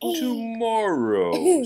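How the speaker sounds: high, slow, stretched tomorrow through a laugh; laughing Toad. Acted. Toad giggling tomorrow at Frog from under the covers. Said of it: "said Toad".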